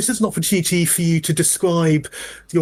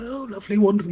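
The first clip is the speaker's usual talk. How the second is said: higher, absent polite approval; half listening; 7 semitones higher, faster than usual. Acted. Conductor humouring a halfling's answer, half listening. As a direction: nod along without really listening. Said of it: "that only half listening to the answer".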